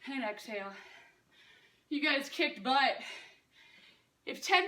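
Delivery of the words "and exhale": soft exhale cue. Shown as a demonstration.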